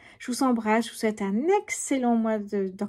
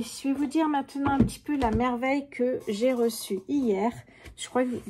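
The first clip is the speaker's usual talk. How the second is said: ordinary talk, nothing marked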